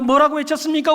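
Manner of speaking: usual speaking voice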